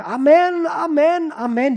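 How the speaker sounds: high, loud repeated Amens; congregation shouting back. Retold or imitated. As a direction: chant it out like a frenzied Pentecostal congregation. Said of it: "die Gemeinde musste immer sagen".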